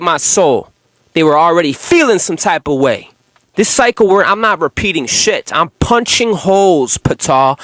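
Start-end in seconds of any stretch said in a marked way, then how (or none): none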